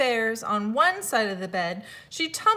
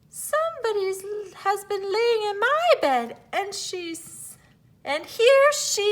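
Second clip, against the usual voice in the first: much higher, sharp tiny bear, louder at the end; little wee bear crying out; 10 semitones higher, 4 dB louder than usual. A narrator's reading. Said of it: "so sharp and so shrill".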